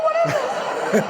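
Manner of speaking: high, drawn-out exasperated cry; exasperated squeal. Acted. Comedian exasperated over what the baby swallows, high squeal. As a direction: stretch it out high in disbelief. Yelling the bit's punchline question.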